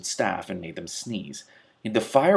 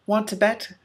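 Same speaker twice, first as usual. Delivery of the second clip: higher teasing dare; high taunting cat; 13 semitones higher, 3 dB louder than usual. Acted. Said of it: "said Trouble".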